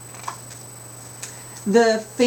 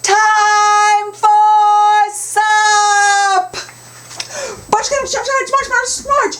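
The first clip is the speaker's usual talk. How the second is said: loud, high, drawn-out yell; long high holler; Grandma yelled; 14 semitones higher, 9 dB louder, slower than usual. Acted. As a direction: call everyone to supper across the farm. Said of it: "Grandma yelled".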